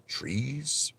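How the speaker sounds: high, soft questioning lift; unsure witness. Retold or imitated. Witness answering the lawyer's quiz, unsure. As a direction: answer tentatively, pitch rising like a question.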